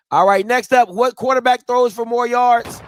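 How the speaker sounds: announcer hype. Acted. Host introducing the quarterback question with announcer hype. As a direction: belt it out like an announcer.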